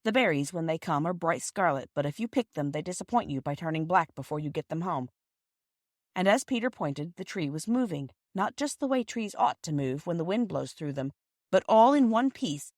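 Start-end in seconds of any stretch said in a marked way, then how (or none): none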